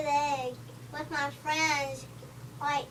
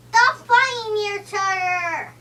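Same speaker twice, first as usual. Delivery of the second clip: loud scolding; 3 semitones higher, 9 dB louder than usual. Pretend. Character scolding in pretend play.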